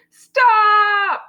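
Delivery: high, drawn-out shout; loud, high-pitched child's yell. A narrator's reading. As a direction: yell it at full voice. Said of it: "Taya yelled very loudly".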